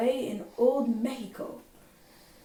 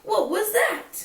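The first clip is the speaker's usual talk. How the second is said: high, louder startled question; 10 semitones higher, 5 dB louder than usual. Acted. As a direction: gasp it out, spooked. Startled by mirror reflection.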